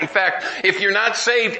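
ordinary talk

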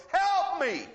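high, rising mock yells for help; retold cry for help. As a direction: call out like the trapped woman. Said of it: "she's crying".

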